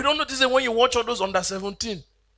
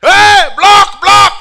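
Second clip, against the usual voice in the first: high, loud yell; mock coach shouting attack; 12 semitones higher, 17 dB louder, much slower than usual. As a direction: scream the order across the field. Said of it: "they shout".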